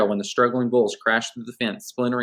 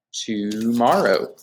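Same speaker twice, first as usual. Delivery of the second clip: drawn out; much slower than usual. Real, not acted. Drawn-out sign-off to class.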